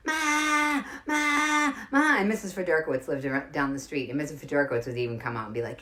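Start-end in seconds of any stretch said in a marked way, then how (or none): 0.1-2.2 high, drawn-out call for mom; mock kid scream. Kids calling mother to cross the street, mock scream. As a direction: holler for Ma like a kid, held long. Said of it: "would scream at the top of our lungs".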